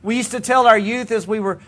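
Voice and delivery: normal talking voice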